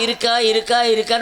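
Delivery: lightly mocking imitation of a questioner; mimicking the questioning. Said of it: "உங்ககிட்ட கேட்டீங்க".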